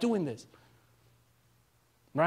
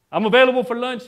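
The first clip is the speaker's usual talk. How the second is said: joking hint; 4 semitones higher, 4 dB louder than usual. Pretend. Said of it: "No, I'm just kidding".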